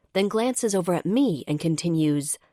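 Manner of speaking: usual speaking voice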